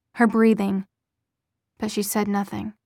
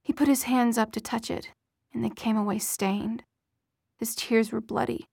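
usual speaking voice

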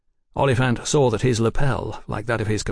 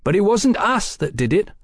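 high, emphatic flat denial; 11 semitones higher, 4 dB louder than usual. A narrator's reading. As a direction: state the denial firmly. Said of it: "Velasco stated flatly".

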